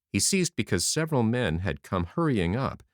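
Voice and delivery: ordinary delivery, nothing marked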